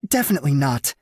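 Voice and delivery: quick defensive denial; flustered woman. A narrator's reading.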